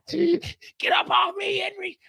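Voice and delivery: high, slow, strained yell; retold shout. Jen yelling at Henry while pinned down, high strained shout. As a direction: struggle to shove an attacker off.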